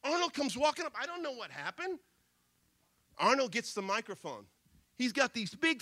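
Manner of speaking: normal talking voice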